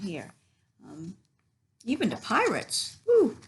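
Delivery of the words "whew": ordinary delivery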